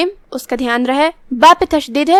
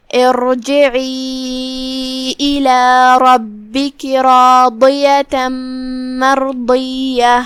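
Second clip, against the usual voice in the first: slow melodic Quran chant, long drawn vowels; tajweed recitation; much slower than usual. Shown as a demonstration. Teacher recites the verses for the class, slow melodic chant. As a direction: recite each phrase slowly, stretching the madd.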